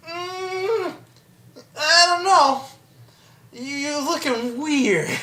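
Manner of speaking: high, soft, hedging whine; wary reluctance. Acted. Sam stalling when Frodo demands the ring, wary. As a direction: hold back in a small, high, doubtful voice.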